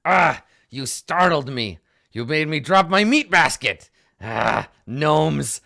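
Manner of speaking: loud, gruff-sounding monster grumble; startled gnoll barking at the gnome; loud grumpy gnoll. Acted. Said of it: "he's like".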